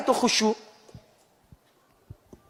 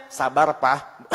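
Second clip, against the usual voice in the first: slow drawn-out reply; wife telling her hungry husband to wait, slowly; patient wife; much slower than usual. Acted. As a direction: stretch it out calmly.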